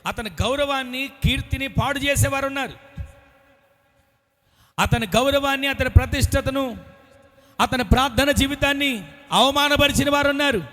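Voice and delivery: high, loud, climbing sermon cry; preaching at full force. Acted. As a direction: build the crowd to a peak. Preaching climax. (ఉచ్చస్థాయిలో బిగ్గర ప్రసంగం)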